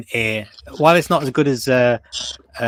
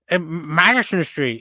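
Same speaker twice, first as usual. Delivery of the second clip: put-on slow, hesitant line in a light Chinese accent; mock Chinese accent; 4 semitones higher, slower than usual. Retelling the witness answering the cop, slow mock Chinese accent. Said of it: "he said it in such a strong accent".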